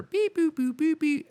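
high robotic beep boop noises; phone beeps. Pretend. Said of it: "that sound that would be made".

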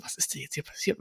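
hushed back-seat whisper; whispering among friends in the back seat. Retold or imitated. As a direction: whisper it so the driver won't hear. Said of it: "wurde so getuschelt von uns".

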